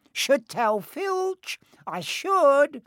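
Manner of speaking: high falsely saintly poltergeist. A narrator's reading. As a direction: play innocent with sly glee. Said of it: "in a saintly voice".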